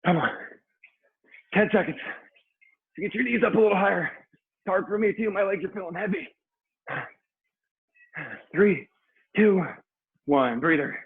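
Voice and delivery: high, pushing workout coach; aerobics instructor. Real, not acted. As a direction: cheer the class through the burn. Pushing class through high knees.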